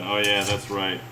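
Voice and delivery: usual speaking voice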